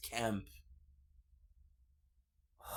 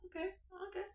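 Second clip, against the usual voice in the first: squeaky okay; 11 dB quieter, much faster than usual. Real, not acted. Squeaky reaction to name.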